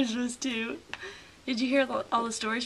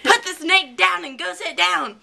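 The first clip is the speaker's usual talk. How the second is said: scolding teacher; 5 semitones higher, 8 dB louder than usual. Retold or imitated. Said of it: "she's like".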